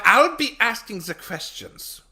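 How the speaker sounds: loud, high German interrogator; German accent. Retold or imitated. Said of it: "as a German".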